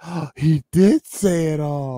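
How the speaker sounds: imitating the kids' amazed discovery; kids amazed. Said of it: "they're just like".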